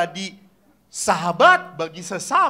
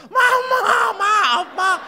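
very high, loud mock bawling; imitated wailing child; 8 dB louder, much slower than usual. Priest imitates children begging mama's forgiveness, exaggerated high wail. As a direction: cry out to mama in hammed-up sobs. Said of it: "guling-guling gitu anak nangis".

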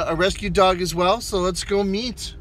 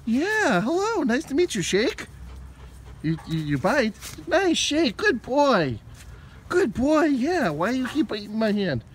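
much higher, cooing puppy voice; 4 semitones higher than usual. Real, not acted. Teaching the dog to shake.

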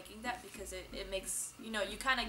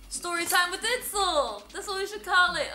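excited; 8 semitones higher, 9 dB louder than usual. Real, not acted. Announcing show name, excited.

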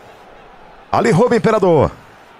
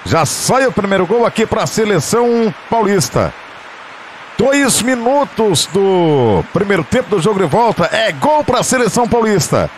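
raised, lively goal announcement; excited goal call; 7 semitones higher than usual. Real, not acted. (anúncio de gol animado)

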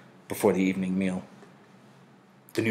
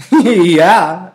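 loud, higher, laughing yeah; laughing reply; 9 semitones higher, 13 dB louder than usual. A narrator's reading. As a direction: shout it back with a laugh.

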